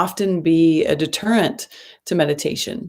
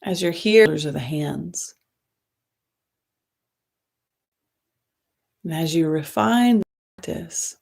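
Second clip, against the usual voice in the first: guided meditation. Acted. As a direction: ease them into the breath, gently and unhurried.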